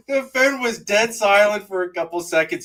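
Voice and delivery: ordinary talk, nothing marked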